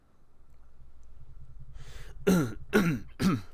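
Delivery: loud, deliberate throat-clearing cough; pointed cough. Acted. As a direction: cough loudly to draw all eyes. Pointed cough before reading ledger.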